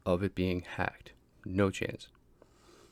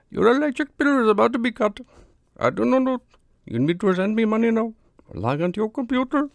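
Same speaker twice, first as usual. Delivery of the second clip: much higher, louder mock Indian scammer accent; hammed-up Indian call-centre scammer, pushy and urgent; exaggerated Indian accent, squeaky and loud; horrible Indian accent; 8 dB louder than usual. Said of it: "It's a horrible accent".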